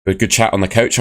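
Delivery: usual speaking voice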